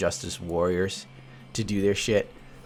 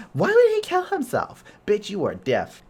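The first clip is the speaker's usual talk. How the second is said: mock outrage; 11 semitones higher, 4 dB louder than usual. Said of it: "you're sitting there like".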